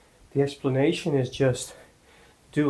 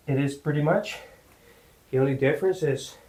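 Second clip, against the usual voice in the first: usual speaking voice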